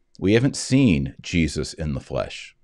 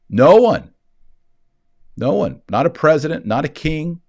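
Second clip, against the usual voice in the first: high, loud fiery preaching; ringing sermon climax; preaching at full force; 7 semitones higher, 5 dB louder than usual. Acted. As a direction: hammer it home from the pulpit. Builds to a forceful peak.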